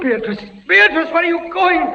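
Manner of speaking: high, loud desperate calling; panicked plea. Acted. Prisoner calling after fading Beatrice, panicked plea. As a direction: beg her not to leave. Pleading as she fades.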